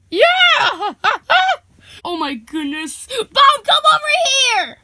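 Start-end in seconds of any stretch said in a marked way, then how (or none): 0.1-1.0 high, loud whoop into laughter; joyful laughing shout. Acted. Joyful reunion. 3.1-4.8 high, loud summoning shout; calling out. Acted. Calling a friend over.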